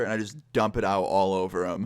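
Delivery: ordinary delivery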